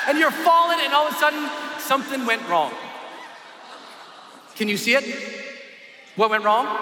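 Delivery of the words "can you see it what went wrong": ordinary talk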